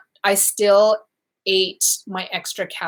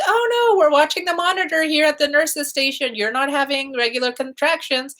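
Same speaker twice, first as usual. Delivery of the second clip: mock nurse; 7 semitones higher than usual. Nurses dismissing her, voiced high.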